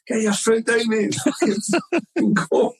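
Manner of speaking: urgent shout to driver. Retold or imitated. Fan urging taxi driver to escape chasing fans, high urgent call. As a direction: yell at the cabbie to get moving.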